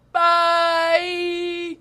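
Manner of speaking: high, loud, long-held bye; sing-song sign-off. Pretend. Hosts signing off to listeners, sing-song farewell.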